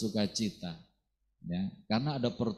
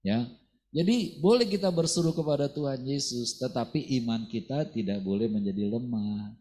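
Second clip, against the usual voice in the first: normal talking voice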